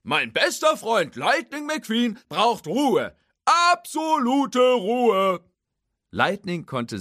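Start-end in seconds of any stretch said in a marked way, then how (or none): 0.0-5.4 high, very loud, drawn-out bellow; slow loud proclamation. Acted. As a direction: stretch each word like a town crier. Hook keeps yelling.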